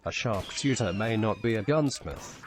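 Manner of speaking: plain reading voice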